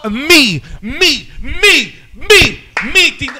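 high, loud repeated syllables like an echo; finto effetto eco. Pretend. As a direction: fake an echo with your own voice, punching each repeat. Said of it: "tiene que ser eco".

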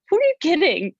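high incredulous jab at herself, Australian meal prep. Real, not acted. Mocking her past self over salads, high incredulous. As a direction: scoff high at your own salad plan.

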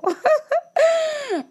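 short high-pitched laugh at herself. Real, not acted. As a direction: laugh off the long rant. Laughing at own ranting.

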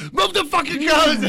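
high, loud, drawn-out shout; exasperated yell. Retold or imitated. Worker repeats his order to Mike, exasperated shout. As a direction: bellow the order with the voice pushed up. Repeats the request, now yelling.

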